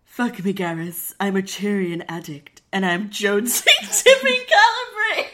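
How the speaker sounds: low sultry voice, breaking into laughter. Acted. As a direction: purr it out seductively. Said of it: "She asked in her sultry voice".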